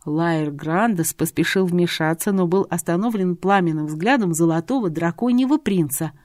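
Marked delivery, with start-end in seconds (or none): none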